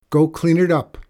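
loud, tightly controlled order; through clenched teeth. Acted. Mom ordering daughter to clean up, through clenched teeth. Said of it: "Mom said through clenched teeth".

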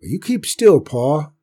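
high, loud sharp order; hard and firm. A narrator's reading. Aunt Bess silences Grandpa, hard and loud. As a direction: shut the old man up. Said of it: "voice became hard and firm".

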